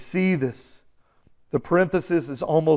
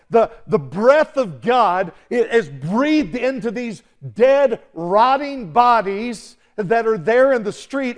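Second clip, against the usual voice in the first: normal talking voice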